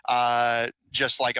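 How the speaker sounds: ordinary talk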